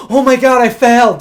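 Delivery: high, loud burst of dismay; panicked dismay. Retold or imitated. Teen driver realizes he failed his test, loud dismay. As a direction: blurt it like you just blew the test. Driving test, missed the signal.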